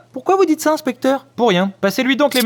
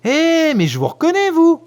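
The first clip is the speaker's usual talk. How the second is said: surprised recognition; 6 semitones higher, slower than usual. Acted. Sudden recognition of the actress.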